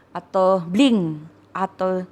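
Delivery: ordinary delivery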